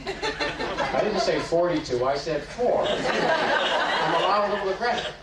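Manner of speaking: chuckling through the words; laughing. Real, not acted. Teacher jokes to class, laughing. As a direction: laugh while correcting yourself.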